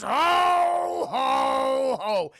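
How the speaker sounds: loud, high Santa ho ho ho; hammed-up Santa bellow; Santa laugh. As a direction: boom it as he shoves the kid. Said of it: "Santa just goes".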